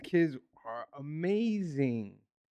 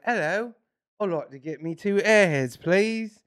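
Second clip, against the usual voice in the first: put-on higher, polite kid's British accent; 5 semitones higher, 5 dB louder, slower than usual. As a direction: imitate a child ordering in British. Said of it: "the British one was main".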